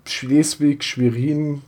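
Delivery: ordinary delivery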